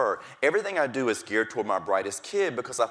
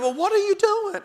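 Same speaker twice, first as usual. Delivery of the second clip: much higher and louder, demanding to know; amazed principal; 7 dB louder than usual. Retold or imitated. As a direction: ask in stunned disbelief. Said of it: "She said".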